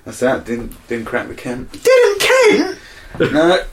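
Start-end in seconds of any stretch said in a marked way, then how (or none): 1.8-3.6 very high, loud squawk; hammed-up high-pitched outburst; mock outraged shout. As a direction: shriek it in disbelief. Mock outrage at chart failure.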